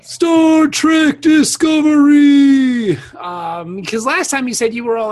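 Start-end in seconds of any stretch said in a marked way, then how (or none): 0.0-3.0 high, loud, drawn-out shout of the title; yelling the show name at a forgetful friend; triumphant shout. Real, not acted. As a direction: blurt the answer out loud and slow.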